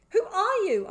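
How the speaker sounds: high, very loud demand; shout. A narrator's reading. Boy pushes off his attacker, shouting. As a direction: shout it right at him. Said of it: "I shouted".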